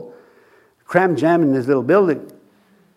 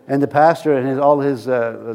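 usual speaking voice

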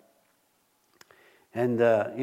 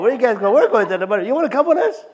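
much higher, louder, laughing through it; excited, laughing; 8 semitones higher, 9 dB louder, faster than usual. Retold or imitated. Pastor voicing his family, excited to get butter. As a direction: hype up a trip for butter. Imagined truckload calling out.

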